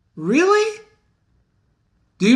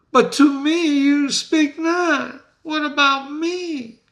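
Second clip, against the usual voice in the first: higher, slow indignant What about me; Banquo protesting; 9 semitones higher, slower than usual. Acted. Teacher voicing Banquo, jealous and higher-pitched. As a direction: whine for your own prophecy. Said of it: "Banquo continues".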